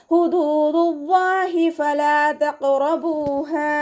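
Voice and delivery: ഫലാ with light ف, ല drawn two counts, slow chant; tajweed recitation. Shown as a demonstration. Teacher models the verse for students, slow chant. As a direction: recite തഖ്റബൂഹാ with heavy ق, high and drawn. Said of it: "ഫ light ആക്കിയിട്ട്".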